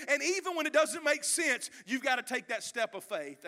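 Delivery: loud, rising preacher's cry; preaching at full force. Acted. Preacher urging the church to obey, loud and rising. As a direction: preach it hard to the crowd.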